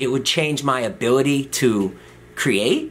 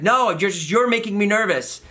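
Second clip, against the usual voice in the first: imitated nervous protest; 10 semitones higher than usual. Nervous patient protesting to doctor.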